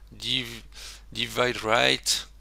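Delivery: very slow "divide right" in a French accent. Shown as a demonstration. Tutor joking about his accent, slow French-accented English. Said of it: "vous adorez mon accent".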